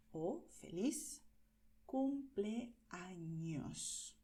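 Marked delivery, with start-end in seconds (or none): 1.8-4.1 cumpleaños drawn out very slowly, clear ñ; very slow careful. Shown as a demonstration. Teacher modelling cumpleaños very slowly. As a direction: stretch every syllable of cumpleaños. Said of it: "Y tienes feliz".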